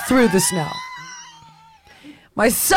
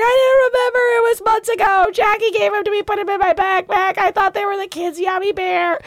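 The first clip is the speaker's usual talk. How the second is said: very high, squealed mock voice; panicked partner; 13 semitones higher than usual. Partner defends eating the kids' gummies, high-pitched mock wail. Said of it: "She's like".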